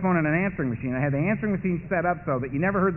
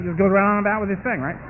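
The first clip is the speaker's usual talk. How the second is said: usual speaking voice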